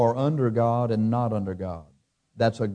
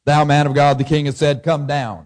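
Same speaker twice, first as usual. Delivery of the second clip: loud, raised-pitch order read out forcefully; disrespectful command; 4 semitones higher, 8 dB louder than usual. A narrator's reading. Captain ordering the prophet down, loud disrespectful command. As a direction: read it as a brusque summons to a prophet. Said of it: "Is that any way to talk to the prophet?".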